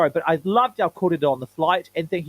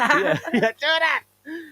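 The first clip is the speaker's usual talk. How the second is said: mock call; 10 semitones higher, much slower than usual. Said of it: "echoing the imagined greeting".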